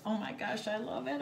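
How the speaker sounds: quietly thrilled; hushed delight. Real, not acted. Overjoyed at gift, first soft take.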